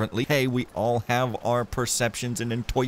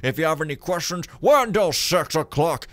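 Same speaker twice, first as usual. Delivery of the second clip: high, loud, sing-song theatrical read; theatrical acting voice; 8 semitones higher, 7 dB louder than usual. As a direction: declaim the note like a stage actor. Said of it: "my theatrical acting voice".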